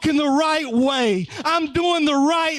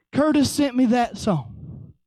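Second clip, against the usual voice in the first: usual speaking voice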